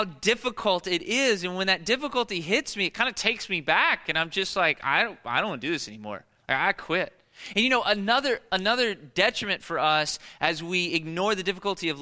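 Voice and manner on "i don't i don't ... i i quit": lower, fast, sulky mock whine; whiny quitter. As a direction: toss it off like a sulky kid giving up. Speaker voicing himself giving up.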